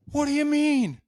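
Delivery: a candidate suddenly scared of losing the job; scared question. Retold or imitated. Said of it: "all of a sudden he's scared".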